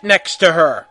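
hammed-up shouted demand; angry yell. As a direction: yell it like a jealous rival. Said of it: "yelled Draco at Vampire".

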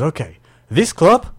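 slow, loud mock Macedonian coach; Macedonian accent. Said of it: "this is my Macedonian accent".

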